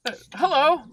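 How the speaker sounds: high timid little voice; squeaky nervous shopkeeper; high nerdy voice. Acted. Motif NPC greeting.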